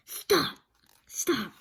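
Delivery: loud, sharp stop orders; loud command. Acted. The hidden Oz halts the friends with a loud command. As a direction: cut them off with a booming order. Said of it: "the voice commanded".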